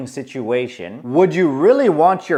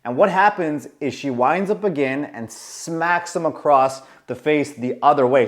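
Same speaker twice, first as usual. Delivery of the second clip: usual speaking voice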